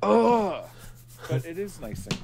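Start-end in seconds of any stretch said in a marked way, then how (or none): 0.0-0.6 high, loud mock "ugh"; hammed-up groan; groan of disgust. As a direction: let out an exaggerated disgusted groan. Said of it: "And I'm like".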